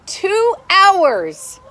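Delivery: disbelieving outburst at the wait; exasperated. Real, not acted. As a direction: cry it out, fed up. Exasperated about hold time.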